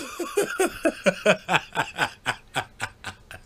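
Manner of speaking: breathless fit of laughing; real laughter. Real, not acted. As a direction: burst out laughing at the memory. Laughing at the story.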